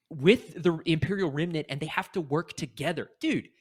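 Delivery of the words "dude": high-pitched exasperated plea; getting heated. Real, not acted. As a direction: plead heatedly, pitch shooting up. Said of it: "I'm getting heated".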